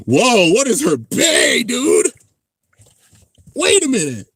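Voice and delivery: loud, high real astonishment; amazed. Real, not acted. Streamer spotting her bay, loud amazement. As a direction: cry out in sudden amazement.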